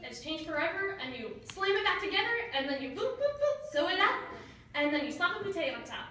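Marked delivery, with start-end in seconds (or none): 2.9-3.7 light little boops, last one much higher; playful boop noises. Retold or imitated. Student miming brain surgery for class, playful boops. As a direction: pop out tiny sound effects.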